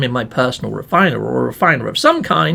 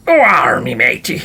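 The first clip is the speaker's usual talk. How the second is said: pirate voice; 7 semitones lower, 3 dB louder than usual. Shown as a demonstration. Mocking having to talk like a pirate, pirate voice. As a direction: put on a hammy pirate "arr". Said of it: "like, a voice of a pirate".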